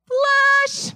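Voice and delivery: mock flush. Acting out flushing a thought.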